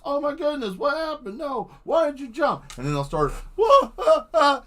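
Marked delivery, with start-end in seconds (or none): 0.0-2.6 high, panicked crewmate voices. Retold or imitated. Crewmates panicking as the captain jumps, high mock alarm. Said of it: "everybody starts". 3.5-4.6 high, loud mock screams; mock screaming. Crewmates screaming as the ship loses power, loud high yelps. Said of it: "start screaming and yelling".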